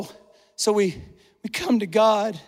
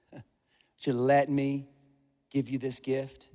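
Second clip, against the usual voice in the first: God; 9 semitones lower, 9 dB quieter than usual. Acted. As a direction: ask softly and low, as a gentle invitation. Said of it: "I wonder if God's asking".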